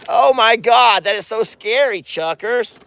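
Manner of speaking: high, startled exclamation; rattled. Real, not acted. Owner startled by Chuck crowding in, rattled. That is so scary.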